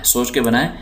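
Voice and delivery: usual speaking voice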